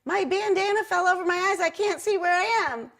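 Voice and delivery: high, lightly put-on voice; muffled mascot voice. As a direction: pitch it up as the stuck mascot. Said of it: "And I'm like".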